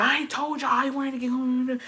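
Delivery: high-pitched mock scolding; retold fury. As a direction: imitate a furious mother-in-law's complaint, not shouted. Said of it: "she was fucking furious".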